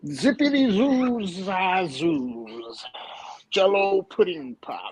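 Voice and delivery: slow, drawn-out nonsense catchphrases; mimicking a rambler. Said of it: "He's like".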